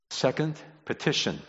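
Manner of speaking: ordinary talk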